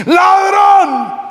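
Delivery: high, drawn-out cry of accusation; accusing shout. Acted. Pastor to the thief as he hits him, accusing shout. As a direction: shout the word high as the blow lands.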